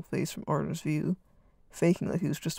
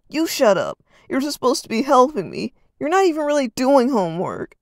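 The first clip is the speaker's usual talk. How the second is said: loud, higher yell; yelled back; 7 semitones higher, 9 dB louder than usual. Acted. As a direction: shout right back at him. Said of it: "Arden yelled back".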